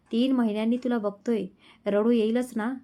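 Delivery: usual speaking voice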